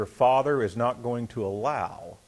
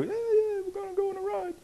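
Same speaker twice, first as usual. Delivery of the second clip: put-on high squeaky kid's glee, much higher; excited kid voice; 3 dB quieter than usual. Pastor imitates his sons eager for the ride, high excited kid voice. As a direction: mimic small sons begging for the ride.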